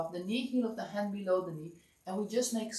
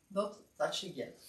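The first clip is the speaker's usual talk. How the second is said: ordinary talk, nothing marked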